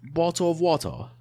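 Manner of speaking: high-pitched, badly faked British accent; hammed-up, wobbly British accent; attempted British accent. Shown as a demonstration. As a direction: botch a British accent into something unplaceable. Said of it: "in a British accent".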